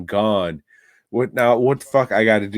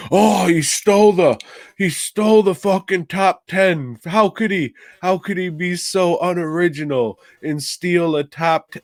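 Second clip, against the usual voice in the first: high, whiny mock outrage; mocking whiny complaint; 8 semitones higher than usual. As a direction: whine it like an offended rival. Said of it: "he got mad that I was saying".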